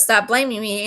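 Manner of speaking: ordinary delivery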